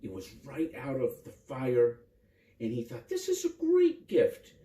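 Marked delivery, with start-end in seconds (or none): 3.1-4.5 much higher, bright with joy; a child thrilled by a gift; boy voiced high and happy; boy's delight.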